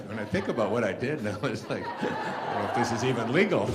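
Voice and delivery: laughing at your own past mischief; laughing in disbelief. Real, not acted. Amused at his own past scheme.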